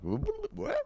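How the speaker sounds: soft, very high stammer; quiet, squeaky bewilderment; hypnotized and startled. Retold or imitated. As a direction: act jolted out of a trance. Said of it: "Oh, I'm hypnotized".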